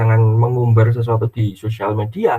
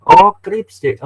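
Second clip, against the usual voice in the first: excited correction; 9 semitones higher, 7 dB louder, much slower than usual. Retold or imitated.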